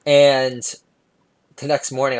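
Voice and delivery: normal talking voice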